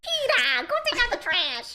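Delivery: high, nose-pinched nagging; Lois impression. Nagging Peter about the trash, in a nose-pinched Lois voice. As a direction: pinch your nose and nag. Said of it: "I have to pinch my nose".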